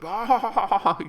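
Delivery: high, loud "aaah" yell; sustained battle yell, imitated; machine-gunner's yell. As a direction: mimic a man yelling over gunfire. Acting brother firing machine gun.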